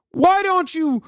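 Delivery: high, mocking whine of a right-wing scold; high squeaky mock outrage; mocking whiny activist. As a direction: sneer it in a squeaky parody voice. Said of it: "but, like, in a right-wing fashion".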